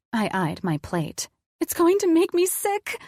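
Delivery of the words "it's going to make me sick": put-on high, childish whining; whiny five-year-old. A narrator's reading. Woman whining about chicken to partner, whiny child voice. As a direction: whine high like an upset small child. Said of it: "whiny voice of a five-year-old".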